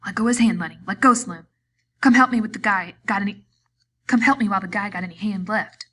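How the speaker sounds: loud, urgent repeated shouts; shouting over and over. A narrator's reading. George shouting at Lenny to release Curly. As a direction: keep shouting, frantic. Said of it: "George shouted over and over".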